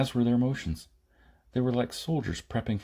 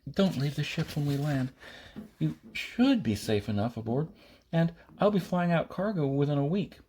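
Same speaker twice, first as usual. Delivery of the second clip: put-on higher, earnest young woman's voice; Maida character voice; 5 semitones higher than usual. A narrator's reading. Maida warning Jason about Pyrrhus, higher and earnest. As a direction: plead with him in her higher voice.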